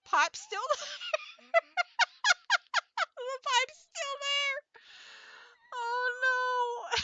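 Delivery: high, drawn-out helpless wheeze; words dissolving into real laughter; laughing. Real, not acted. Streamer noticing the pipe is still there, laughing. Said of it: "I'm laughing while Klonoa's crying".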